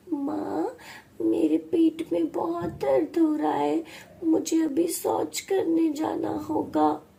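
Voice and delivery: put-on high, distressed girl's voice; young girl in urgent discomfort; pained daughter. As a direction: plead to mother through stomach pain. Girl in pain pleads with mother.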